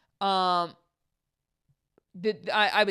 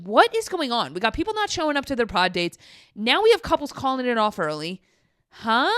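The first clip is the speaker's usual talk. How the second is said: louder, higher imitation of baffled producers; mock exasperation; 4 semitones higher, 6 dB louder than usual. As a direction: demand answers, loudly bewildered, in imitation. Voicing a baffled, exasperated Netflix.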